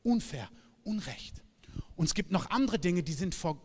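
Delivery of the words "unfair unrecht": quiet stubborn quote. Retold or imitated. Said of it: "der andere sagt".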